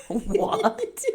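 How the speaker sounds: high incredulous what with a laugh; laughing disbelief; wife stunned by a sleepwalking request, laughing; baffled what. Retold or imitated. Baffled at tape measure.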